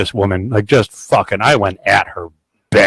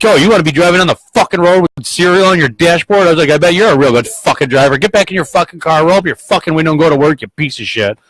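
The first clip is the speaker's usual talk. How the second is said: higher, louder sarcastic scolding; retold angry tirade; 6 semitones higher, 4 dB louder than usual. Plow driver berating woman with cereal on dashboard, retold angry tirade. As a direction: re-enact chewing out a careless driver.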